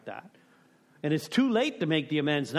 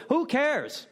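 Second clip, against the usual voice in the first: high, louder dismissive burst; exasperated who cares; 11 semitones higher, 4 dB louder than usual. Real, not acted. To the group, dismissing the step debate, loud and exasperated. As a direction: toss the debate aside loudly.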